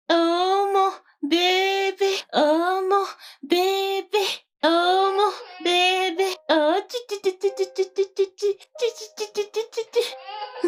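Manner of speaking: put-on high crooning baby talk, tu tu tu; baby cooing. Woman soothing a crying baby.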